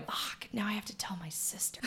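whispered under the breath in panic; panicked whisper. Retold or imitated. Aunt to herself after breaking the fan, whispered. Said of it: "But I'm going".

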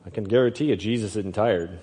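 ordinary delivery

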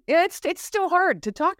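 a real catch of tears in the voice; teary. Real, not acted. Veteran telling her interviewer about trauma, really teary. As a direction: choke up while pushing the words out. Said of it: "Look at me getting all teary-eyed".

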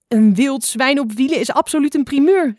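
a high, loud, jeering shout; calling out. A narrator's reading. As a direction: cry it out with glee. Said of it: "riep Spotlijster".

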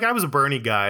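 normal talking voice

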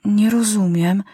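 lightly voiced puzzled boy; King Maciuś admitting to the minister he doesn't understand; boy admitting confusion. A narrator's reading. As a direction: admit it plainly. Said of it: "przyznał król Maciuś".

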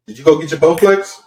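usual speaking voice

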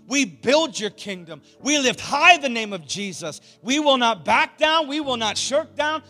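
loud, high preaching prayer; rally speech. Real, not acted. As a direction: pray it like a battle cry. Closing prayer at full force.

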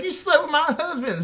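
high-pitched mock accusation; hammed-up high squabbling voice; trashy talk-show accuser. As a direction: play a wronged wife on Jerry Springer. Acting out trashy figure-skating Jerry Springer row.

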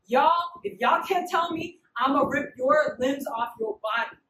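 ordinary talk, nothing marked

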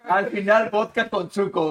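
high-pitched, laughing repetition. Real, not acted. Friend repeating the absurd drink mix, laughing. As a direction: crack up while repeating the punchline.

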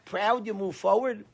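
usual speaking voice

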